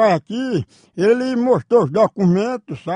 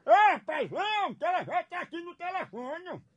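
calling out far off; 6 semitones higher, 13 dB quieter than usual. Acted. Calling the man from afar.

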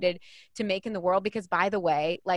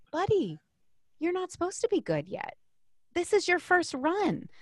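put-on high, gently coaxing words to a small child; lightly voiced patient mother; reassuring a frustrated little boy on the ski slope; gentle mom to son; 10 semitones higher than usual.

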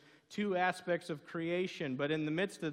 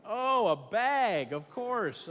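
drawn-out, higher, loud "Oh, a bag"; mock realization; 6 semitones higher, 6 dB louder, much slower than usual. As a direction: act out the penny finally dropping, loud and high. Acting sudden understanding of clerk.

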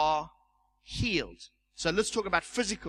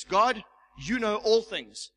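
prayer; 3 semitones higher, 5 dB louder, slower than usual. Retold or imitated. Praying over a sick woman.

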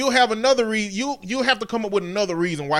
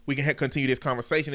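usual speaking voice

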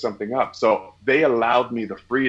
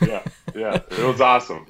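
laughing. Real, not acted. Laughs while answering.

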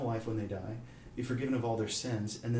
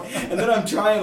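normal talking voice

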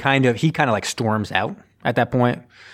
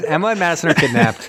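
ordinary talk, nothing marked

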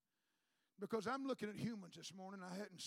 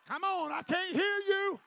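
loud, slow, higher call to the crowd; 8 semitones higher, 12 dB louder, slower than usual. Real, not acted. Preacher urging the church to answer, loud and drawn out. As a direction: coax a louder answer from the pews.

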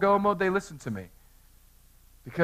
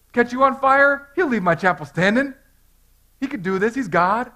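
confident retort; 6 semitones higher, 5 dB louder than usual. Retold or imitated. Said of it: "I tell them all the time".

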